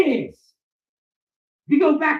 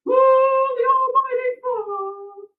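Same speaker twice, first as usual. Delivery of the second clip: high, slow, sarcastic awe; mock reverence; 11 semitones higher, much slower than usual. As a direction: gush over it like a holy relic, mockingly. Mocking worship of a word.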